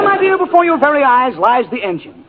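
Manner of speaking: ordinary talk, nothing marked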